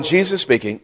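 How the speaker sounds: normal talking voice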